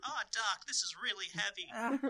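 high, slow put-on voice; hammed-up slow lament to Doc; mock movie line. Friend quoting a movie to Doc, hammed-up.

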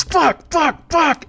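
high, loud furious swearing; frustrated yelling. Retold or imitated. Drunk friend swearing at an unanswered call, loud furious outburst. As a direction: explode in frustration.

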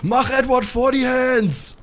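put-on high, slow, sing-song drunken holler; mock drunken yell from a passing quad; shouted while passing. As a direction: belt it out like a drunk racing by. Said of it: "Sören schreit im Vorbeifahren".

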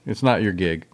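ordinary delivery

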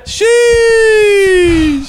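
high excited shout. Real, not acted. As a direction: yell it out in a squeal. Reacts to the spin.

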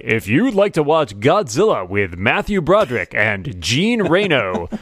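slow, drawn-out automated phone voice; mock automated announcer; Movie Phone announcer. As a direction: read it like the old recorded movie line. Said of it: "it's like".

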